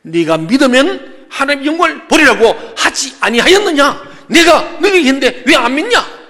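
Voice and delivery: high, loud shouted scolding; furious rebuke. Acted. Jesus scolds those who would not believe, furious shout. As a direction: shout the reproach, hammering each phrase. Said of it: "너무 격분하셔서".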